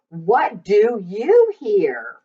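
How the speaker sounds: plain reading voice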